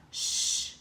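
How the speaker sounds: long hushing shh; sh sound. Shown as a demonstration. Teacher showing the class the sh sound, hushing. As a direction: hush it out like a librarian. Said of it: "they make that sound".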